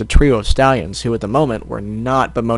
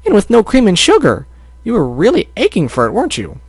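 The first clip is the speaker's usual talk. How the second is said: high-pitched, amazed bakery lady; exclaiming; 9 semitones higher than usual. Acted. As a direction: marvel at the customer in a high, swooping voice. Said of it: "Missus Cake exclaimed".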